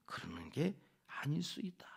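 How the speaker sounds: hushed whisper; whispered reassurance. Acted. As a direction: say it softly as a gentle reminder. Whispered point.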